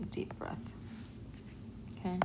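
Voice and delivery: ordinary delivery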